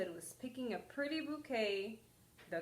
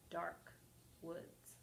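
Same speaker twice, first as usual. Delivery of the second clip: plain reading voice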